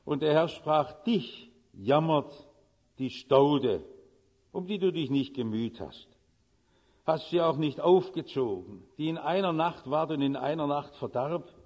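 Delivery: low, measured Bible reading; solemn scripture reading. Acted. Reads Bible passage solemnly.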